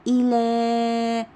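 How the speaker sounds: الى with the final aa held very long; teacher's drawn-out الى; elongated final vowel. Shown as a demonstration. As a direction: sound out الى slowly on a sustained last vowel. Teacher sounding out example word.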